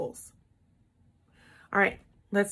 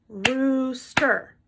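roo-ster split in two beats; syllable clapping. Shown as a demonstration. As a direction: clap each syllable of rooster. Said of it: "So let's clap".

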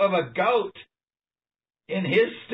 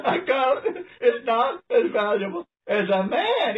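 chuckling through the words, pitched up; laughing repeat; 4 semitones higher than usual. Real, not acted. Preacher marvels at the goat line for listeners, laughing.

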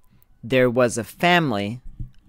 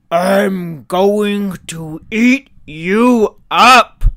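slow, much louder, higher monster threat; troll growl; 8 semitones higher, 13 dB louder, slower than usual. Acted. Troll jumping out at the little goat, growling threat. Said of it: "growled the troll".

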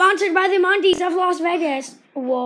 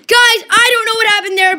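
louder, rising play-by-play as the shot flies; breathless excited commentator; excited call; 7 semitones higher, 9 dB louder than usual. Real, not acted. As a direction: hype up the near miss.

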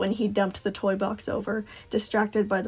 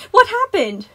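put-on high, loud little-girl question; curious child; 12 semitones higher, 10 dB louder than usual. A narrator's reading. Girl popping from the ball pit, loud curious question. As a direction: blurt it out the moment you arrive. Newly arrived child asks urgently.

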